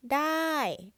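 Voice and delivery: dai starting high and falling; Thai falling tone. Shown as a demonstration. Teacher modelling dai with a falling tone. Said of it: "falling tone again".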